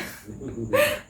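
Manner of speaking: a breathy, high little giggle of embarrassment; embarrassed giggle. Real, not acted. Mother admitting an embarrassing birth moment, embarrassed giggle. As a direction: laugh it off, shy and caught out.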